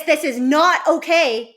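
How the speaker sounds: loud, high-pitched telling-off, stretching the words; sharp scolding demo. Shown as a demonstration. Coach showing parents what to avoid, sharp scolding. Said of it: "instead of, like, talking like this".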